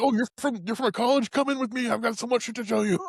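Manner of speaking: high, overeager welcome; eager mock voice. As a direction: play him gushing with excitement. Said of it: "He's like".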